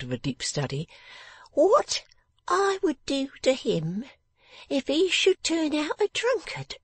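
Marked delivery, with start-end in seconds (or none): 1.5-6.8 very high, slow, brooding voice; brooding little Jenny. Acted. Jenny tells Lizzie her thoughts about a future husband, brooding. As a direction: muse slowly in a high little voice.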